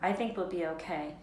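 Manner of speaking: usual speaking voice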